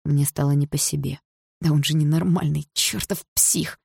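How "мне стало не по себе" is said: plain reading voice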